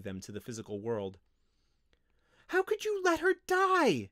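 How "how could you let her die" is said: loud, very high, furious accusation; spitting outrage. A narrator's reading. Windling accusing her teacher, furiously. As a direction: spit it out in a high, angry cry. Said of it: "she spat out".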